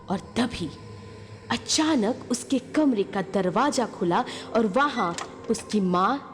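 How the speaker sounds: ordinary delivery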